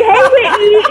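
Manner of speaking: bright, high sing-song hello; playful imitation of an upbeat check-in greeting; perky greeting. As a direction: chirp the name like a cheerful host. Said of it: "you was just like".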